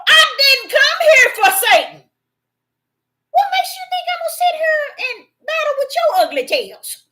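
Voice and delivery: loud, high incredulous outburst; indignant retort. Acted. Preacher voicing Yeshua to the congregation, indignant. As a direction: raise it high and loud, affronted.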